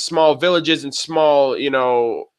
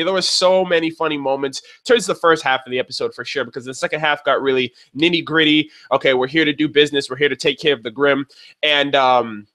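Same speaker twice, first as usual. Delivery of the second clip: ordinary delivery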